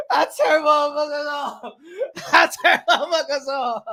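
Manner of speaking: words squeezed through laughter; amused, breaking into laughs; laughing. Real, not acted. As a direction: say it while cracking up. Laughing at his own joke.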